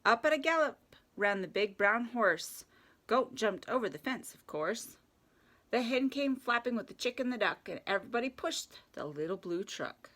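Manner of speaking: lively rhyming picture-book read; children's story narration. A narrator's reading. Reading Little Blue Truck to children at story time, playful narration. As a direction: read it playfully with animal sounds.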